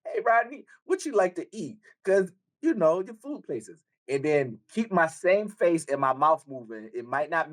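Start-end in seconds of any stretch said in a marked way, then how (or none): 0.1-3.8 high, lightly voiced friend impression; playful mimic of his friend, pitched up; imitating friend. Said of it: "T-Ron gonna say".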